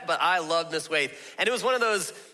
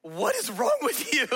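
exasperated mom; 8 semitones higher than usual. Retold or imitated. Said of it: "Mom's like".

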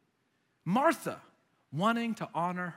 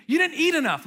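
high, loud motherly scolding; insistent mom; 8 semitones higher, 6 dB louder than usual. Acted. Mom pressing food on her own child, insistent. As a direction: insist loudly as a fussing mother. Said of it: "my mom does it to me".

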